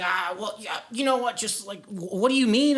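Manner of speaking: imitating a just-woken friend; groggy waking up. Just-woken friend answering an alarming call, mock groggy. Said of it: "like, just waking up".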